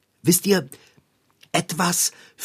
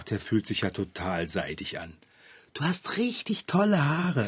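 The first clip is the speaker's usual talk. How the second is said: hushed flattery; 8 semitones lower, 6 dB quieter than usual. Acted. Sneaky flattery whisper.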